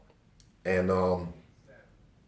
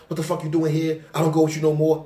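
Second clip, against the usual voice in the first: put-on higher, louder scolding by girlfriend; pushed-up mock telling-off; angry confrontation; 6 semitones higher, 3 dB louder than usual. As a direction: imitate her marching up to tell him off. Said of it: "marching up to me like".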